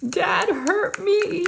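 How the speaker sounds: put-on high, slow, whimpering kid; high crying little-girl whine; whiny child voice. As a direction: imitate a daughter whining to mom. Child crying complaint to mom.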